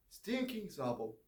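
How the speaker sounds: ordinary delivery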